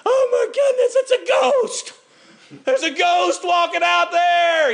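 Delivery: very high, hammed-up panic; mock terrified cry. Disciple spotting Jesus on the waves, mock terrified wail. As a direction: wail it in comic fright, stretching the last words. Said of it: "crying and moaning looks out there and says".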